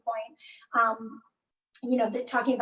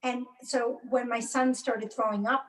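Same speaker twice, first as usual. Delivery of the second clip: ordinary delivery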